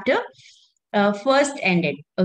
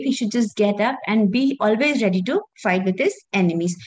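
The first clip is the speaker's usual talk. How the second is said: normal talking voice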